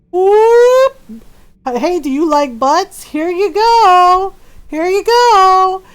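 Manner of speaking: loud, high, drawn-out teasing offer; mock the game flaunting her butt, loud and high; hammed-up leering camera. Mocking the game camera as it shows off Miranda, loud and high. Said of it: "the appropriate time to be like".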